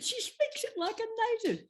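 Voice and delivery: laughing through a teasing reply; Parkinson's reply. Retold or imitated. Parkinson answering the floor manager, laughing mock voice. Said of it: "He said".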